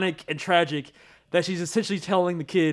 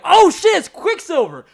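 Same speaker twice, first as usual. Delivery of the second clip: surprised; 11 semitones higher, 9 dB louder than usual. Retold or imitated. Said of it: "I was like".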